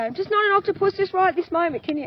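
a mum pushed to her limit; frazzled mum; exasperated. Retold or imitated. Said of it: "And I, I say".

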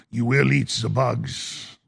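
low, slow villain voice; sinister villain. Acted. Host playing evil billionaire ordering people, low menace.

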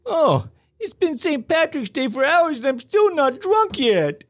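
loud, high, slow cartoon dad; drawn-out high cartoon voice; Homer Simpson. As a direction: belt it out as a dopey cartoon character. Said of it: "Homer Simpson".

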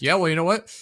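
imagined hypocrite moment, mock angry shouting; mock blow-up. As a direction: snap at him in a pretend rage. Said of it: "I'm gonna be like".

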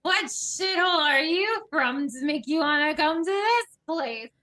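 put-on slurring drunk. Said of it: "slurring his words".

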